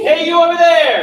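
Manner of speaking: loud, high holler; full projected chest call; big, thick shout. Shown as a demonstration. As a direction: shout it across the room. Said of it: "more of a big, thick sound".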